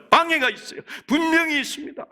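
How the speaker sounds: high, forceful declaration; insistent, high-pitched warning; emphatic preaching. Real, not acted.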